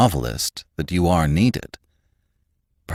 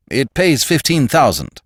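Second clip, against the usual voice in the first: high, loud enticing offer; persuasive; 9 semitones higher, 6 dB louder than usual. A narrator's reading. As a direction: dangle the salary persuasively. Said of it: "His tone was actually persuasive".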